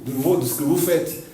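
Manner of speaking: ordinary talk, nothing marked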